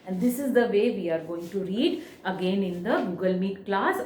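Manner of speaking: ordinary talk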